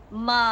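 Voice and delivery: "Ma" dipping down then up like a V; third tone demo. Shown as a demonstration. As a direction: trace a V with the voice on "Ma". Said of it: "The correct way to pronounce".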